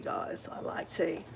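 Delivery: a soft slow whispered aside. Real, not acted. Vlogger whispering to her viewers about her love of tea. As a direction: lean in and whisper it like a secret.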